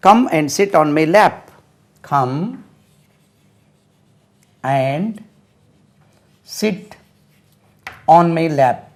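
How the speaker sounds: drawn out, word by word; very slow dictation. Shown as a demonstration. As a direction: spell it out slowly for the class. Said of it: "slow model sentence for children".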